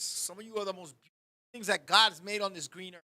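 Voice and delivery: normal talking voice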